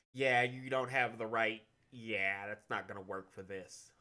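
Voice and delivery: low, soft, offhand brush-off; imitating a bored casting director, low and quiet; dismissive casting rejection. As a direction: let them down quietly and indifferently. Voices casting people rejecting an actress.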